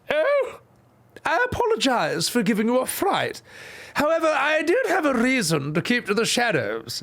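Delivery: high put-on queen voice; a failed high-voiced take; wrong voice. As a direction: pitch it up high and dainty. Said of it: "Wrong, wrong, wrong".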